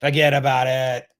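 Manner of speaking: badly faked Boston accent; half-baked stab at a Boston accent; shaky Boston accent attempt. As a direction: toss it off in a botched Boston accent. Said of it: "I gotta work on that".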